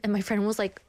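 ordinary talk, nothing marked

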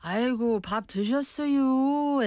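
high, lilting Chungcheong drawl; 충청도 dialect. Shown as a demonstration. Showing how 충청도 people talk, drawling Chungcheong accent. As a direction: imitate a Chungcheong local, voice lifted and vowels stretched. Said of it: "they're usually very slow".

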